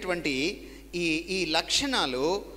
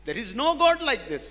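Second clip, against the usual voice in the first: proclaiming; 8 semitones higher, 7 dB louder, much slower than usual. Shown as a demonstration. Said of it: "repeated line, two deliveries".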